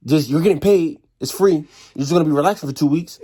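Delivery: loud, high mock rant; incredulous yelling. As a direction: throw it at him in disbelief. Retelling sergeant's yelling.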